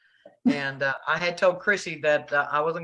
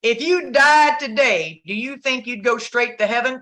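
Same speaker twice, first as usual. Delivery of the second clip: quoting the preacher woman; 7 semitones higher, 6 dB louder than usual. Retold or imitated. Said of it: "this woman says".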